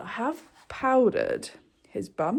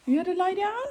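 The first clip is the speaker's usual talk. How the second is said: teasing pet talk; 10 semitones higher, faster than usual. Real, not acted. Questioning horse about his bed.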